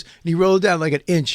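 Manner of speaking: ordinary talk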